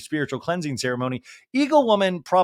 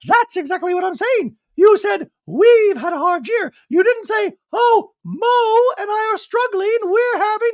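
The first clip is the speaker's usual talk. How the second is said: much higher, louder, shrill-edged mock Dorit voice; 7 dB louder than usual. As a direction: whine it like a caricature of her. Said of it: "And Dorit goes".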